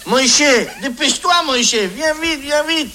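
high, urgent mock panic; a wife hurrying her husband under bombs; wife calling her husband in mock panic under bombing; panicked calling.

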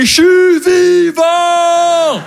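long drawn-out high shout; stretched triumphant yell. Pretend. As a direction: cry out as if you're alive. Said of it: "en train de crier".

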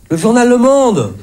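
mock grandeur. Mock pompous naming of the paper.

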